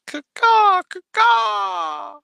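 high shrill pterodactyl caw; shrill screeching bird woman. Pretend. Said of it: "I'm starting to feel a little ridiculous".

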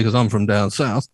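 ordinary talk

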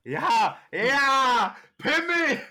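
shouting. Real, not acted. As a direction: blurt it out loudly. Childish shout.

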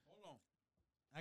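talking with ice in mouth. Real, not acted. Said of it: "I got ice in my mouth".

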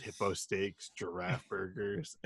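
low, soft, deadpan salesman; Dwight impression. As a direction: murmur it like a shady dealer. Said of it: "He's like".

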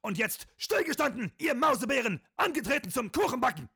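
very high, barked drill-sergeant orders; stiff barking toy soldier. Acted. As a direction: bark it like a tiny wind-up sergeant. Comic drill skit, sergeant. (hoher, zackiger Spielzeugsoldaten-Befehlston)

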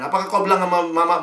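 ordinary talk

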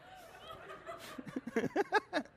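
high-pitched giggle; high giggling laugh. Real, not acted. Pastor laughing at his kitten story, high giggle. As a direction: break into a squeaky giggle.

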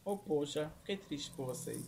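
low, very soft fake pity; mocking sympathy. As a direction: murmur insincere condolences. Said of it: "O deboche, meu pai". (deboche com falsa tristeza)

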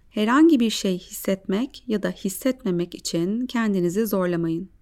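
calm guided breathing voice. Acted.

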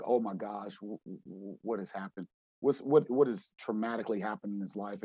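quietly recalling his own dismay; hushed concern. Retold or imitated. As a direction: drop to a hushed, stunned aside. Coach recalls seeing player crying.

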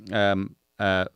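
ordinary talk, nothing marked

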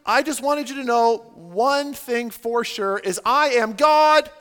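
loud, slow mock proclamation; super-clear announcement. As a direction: announce it to a whole crowd, building on the last word. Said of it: "super clearly like".

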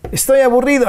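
high, whiny bored complaint; bored whine. Acted. Radio host acting out a bored listener, high whiny complaint. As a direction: whine it like a restless kid with nothing to do.

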